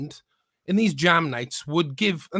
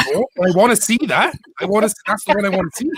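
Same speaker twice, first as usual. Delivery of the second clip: louder, fast, playful demand; eager teasing insistence; 2 semitones higher, 7 dB louder, much faster than usual. Retold or imitated. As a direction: push for the embarrassing photo with glee. Teasing Mark about the photo.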